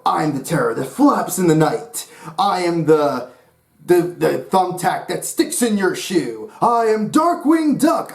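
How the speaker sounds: hammed-up hero voice, building to a high slow shout; Darkwing Duck catchphrase. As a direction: boom out the corny hero intro, bigger each line. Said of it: "he had such corny lines".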